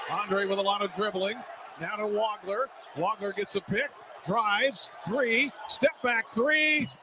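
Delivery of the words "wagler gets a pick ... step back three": rising, near-shouting play call, pitch climbing high; excited call. Real, not acted. Commentator calling the play as the shot and rebound happen, excited shout. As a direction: voice jumps up as the three goes up and the rebound battle breaks out. Fast break, missed three, scramble.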